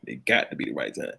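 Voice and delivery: whispered repeat. Real, not acted. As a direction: drop to a whisper. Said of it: "repeated as whisper".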